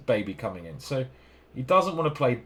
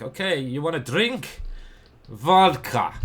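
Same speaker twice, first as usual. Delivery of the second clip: put-on Russian accent; 5 semitones higher, 7 dB louder, slower than usual. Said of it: "And they're like".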